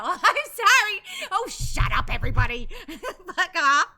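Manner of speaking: loud, playful yell at hecklers; mock outrage. Streamer telling everybody to shut up, mock outrage. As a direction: shout them down with a laugh. Mock scolding of the teasing audience.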